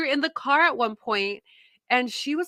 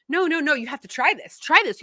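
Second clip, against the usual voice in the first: higher, insistent coaxing; acted pushy urging; 5 semitones higher, 3 dB louder than usual. Imitating herself urging people to try things, pushy. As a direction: act out someone who won't take no. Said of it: "I'm used to being that person that's like".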